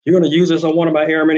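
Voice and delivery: fast, loud, high mock yell; imitated yelling commander. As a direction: rattle it off like an outraged officer. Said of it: "he's screaming".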